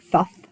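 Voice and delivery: thuth with a TH sound at both ends; thuth, lisped th opening and closing the word; TH pronounced together. Shown as a demonstration. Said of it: "T H pronounced together like".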